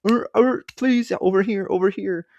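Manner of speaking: high-pitched barking sea lion begging; SeaWorld sea lions. Acted. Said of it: "you know, the SeaWorld sea lions".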